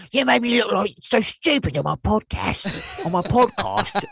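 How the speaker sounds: high, slow mock British voice; hammy posh Brit complaining; British accent. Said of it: "say that word with a British accent".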